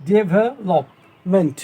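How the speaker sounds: "ডেভেলপমেন্ট" slowly, in four separate pieces; split into four syllables. Shown as a demonstration. Teacher counting syllables for pupils, "ডেভেলপমেন্ট" broken in four. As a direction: pause between the syllables of "ডেভেলপমেন্ট". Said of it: "চারটে syllable হয়ে গেল".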